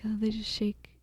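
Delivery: whispered mock reaction; hushed imitation. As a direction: whisper it as the onlookers would. Imitates how everyone else reacts.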